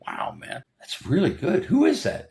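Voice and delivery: loose, swooping wowed pitch, mock star voice; John Travolta amazed. John hears the songs over lunch, mock awed. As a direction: play it like a movie star hearing a hit song. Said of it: "John's there".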